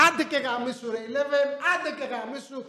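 high, soft, strained curse; exasperated cursing. Real, not acted. Fed-up viewer cursing Eleven, exasperated. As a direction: curse her in a high, hushed voice.

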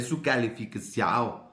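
slow Brazilian Portuguese ending on "calificación", like "-ção"; Brazilian accent. Acted. Host recalls a student wanting her grade, Brazilian accent. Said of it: "como brasileño".

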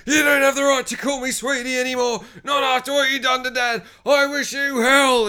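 put-on high, loud-edged whiny teen outburst; angry kid. Son lashing out at his mum over dinner, mock angry kid. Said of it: "you're an angry kid".

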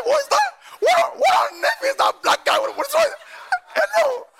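high, loud shocked cry; shocked shout. Retold or imitated. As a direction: yell in disbelief at yourself. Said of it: "I said".

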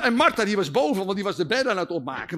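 normal talking voice

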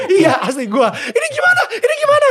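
high, loud mock panic, laughing. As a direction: squeal like you're panicking, half laughing. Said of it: "Gue kayak".

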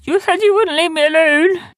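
high, loud creature wail; high-pitched distressed yelling; pleading Sniz. Acted. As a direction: protest being left behind.

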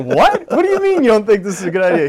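high, loud, disbelieving retort; incredulous reaction. Retold or imitated. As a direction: act out a friend's rapid-fire disbelief. Retelling friend's incredulous reaction.

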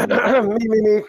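high, loud singer's warm-up; mock vocal warm-up. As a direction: warm up the voice showily before a performance. Mock vocal warm-up before the trailer.

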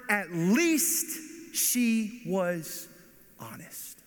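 slow build, then drop to a whisper on honest; whispered emphasis. Real, not acted. Preacher stressing Naomi's honesty to the congregation, slow then whispered. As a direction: lean in and breathe the last word. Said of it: "dramatic whispered emphasis".

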